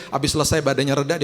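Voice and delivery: usual speaking voice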